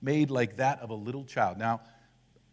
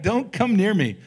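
raised, mock-disgusted push-away; mock recoil; 7 semitones higher, 7 dB louder than usual. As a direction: pretend to shoo the kid off, half joking. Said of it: "I'm like".

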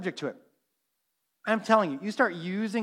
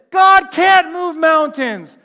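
slow, loud defiant shout, much higher; loud mocking retort; 8 semitones higher, 11 dB louder, much slower than usual. Preacher mimicking a heckler shouting back during worship. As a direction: bellow the heckler's denial slowly.

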